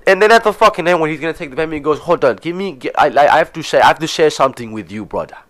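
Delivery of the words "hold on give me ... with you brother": imitated Ugandan accent, confiding sales pitch. Colleague stops customer at payment, mock Ugandan accent. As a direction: put on a Ugandan accent to make an appeal. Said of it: "he's talking like that".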